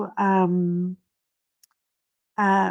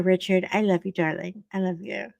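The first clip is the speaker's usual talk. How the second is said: usual speaking voice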